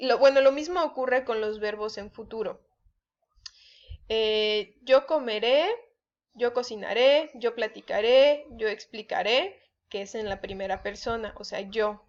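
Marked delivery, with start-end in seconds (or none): 4.8-9.6 "comeré", "cocinaré", "platicaré", "explicaré" each stressed on final RÉ; stress on final syllables. Shown as a demonstration. Teacher listing future verbs, each "-ré" ending stressed. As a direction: list "comeré" to "explicaré", landing hard on each RÉ. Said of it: "igual llevan acento en la última".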